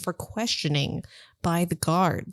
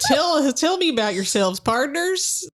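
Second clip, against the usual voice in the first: put-on Western accent; 5 semitones higher than usual. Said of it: "That sounds more like a cowboy".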